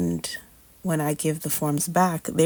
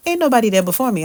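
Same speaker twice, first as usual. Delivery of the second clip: indignant insistence; 6 semitones higher, 8 dB louder, much faster than usual. Retold or imitated. Insisting to the sonographer.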